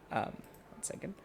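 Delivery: unvoiced quick aside; whispered aside. Real, not acted.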